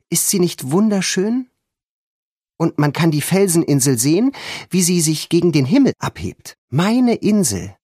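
lightly voiced girl, proud of her island; girl at the window. A narrator's reading. George talks to Timmy at window.

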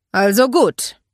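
loud, firm officer's voice; commanding. Acted. Mark addressing boys lined up like troops, commanding. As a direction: take charge of the troop loudly.

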